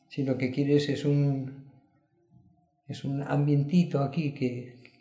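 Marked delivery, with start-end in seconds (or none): none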